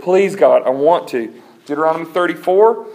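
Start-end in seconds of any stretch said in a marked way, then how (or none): 0.0-1.3 loud begging; hammed-up insistent plea; acted praying. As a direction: plead loudly with God. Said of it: "Talk about praying in faith".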